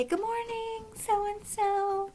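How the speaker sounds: lightly sweet parent waking the house; parent's greeting. Retold or imitated. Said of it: "when you wake up and you say".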